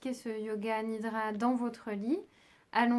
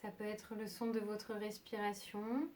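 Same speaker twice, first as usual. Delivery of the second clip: soft, unhurried meditation voice with long pauses; calm yoga nidra guidance, leaving long silences; guided meditation; 4 dB quieter than usual. Acted. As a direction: guide them gently towards sleep, phrase by phrase.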